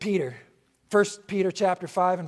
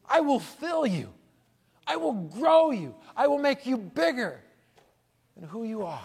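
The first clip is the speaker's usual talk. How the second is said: slow, higher, resonant voice of God; God's promise; 7 semitones higher, slower than usual. Acted. Preacher voicing God promising blessing, slow and lifted. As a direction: stretch each promise out, lifted and grand. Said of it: "it literally means".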